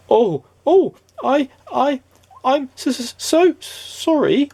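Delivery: high, halting nervous stammer with slow repeats. A narrator's reading. Mister Good bumping into a stranger, stammering nervously. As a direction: stumble over the apology, high and flustered. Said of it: "stammered Mister Good nervously".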